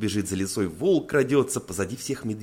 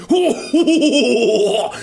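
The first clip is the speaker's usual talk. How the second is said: big, high, loud whooping belly laugh; gleeful bear ho-ho-ho; overjoyed bear; 13 semitones higher, 12 dB louder, much slower than usual. A narrator's reading. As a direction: whoop with joy, long and drawn-out. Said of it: "радуется медведь".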